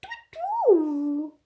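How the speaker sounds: high sung ding-dong of a doorbell; doorbell sound. Acted. Teacher imitating the doorbell for the children.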